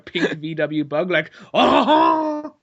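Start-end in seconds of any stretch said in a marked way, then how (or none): 1.5-2.5 high, loud, drawn-out mock swoon; amorous cartoon cry. Imitating cartoon car chasing a pink bug, amorous cry. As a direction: let out an exaggerated lovestruck cry. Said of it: "Like he's chasing a V W bug".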